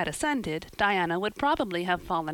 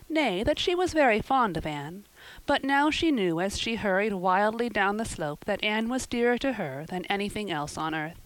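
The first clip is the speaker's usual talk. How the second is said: ordinary talk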